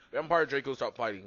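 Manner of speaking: ordinary delivery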